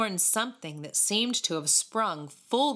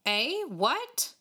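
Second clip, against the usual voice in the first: high, startled questions; taken aback, pitch jumping up; surprised; 7 semitones higher than usual. A narrator's reading. Boys stunned by David's refusal, surprised. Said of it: "could scarcely have been more surprised".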